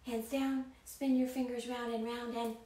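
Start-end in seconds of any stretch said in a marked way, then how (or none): none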